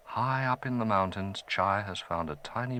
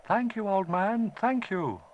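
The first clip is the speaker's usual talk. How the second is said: higher, lightly voiced boy; grateful boy; 12 semitones higher than usual. Chai thanking the departing old man, grateful. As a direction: call out thanks gladly.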